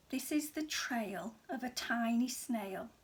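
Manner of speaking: plain reading voice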